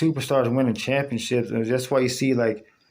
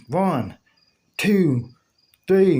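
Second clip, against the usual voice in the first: referee count; 5 semitones higher, much slower than usual. Retold or imitated. Referee counting a pin aloud.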